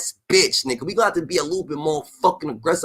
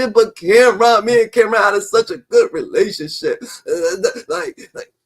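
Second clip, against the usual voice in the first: hammed-up whiny fake sob, pitched up; mock crying; 5 semitones higher, 4 dB louder than usual. Streamer imitating [name] crying over [name], hammed-up fake sob.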